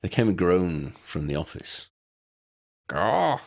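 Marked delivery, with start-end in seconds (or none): 2.9-3.4 high squawking chicken call. A narrator's reading. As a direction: squawk it like a dazed hen. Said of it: "said the chicken".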